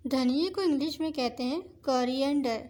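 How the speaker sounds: ordinary delivery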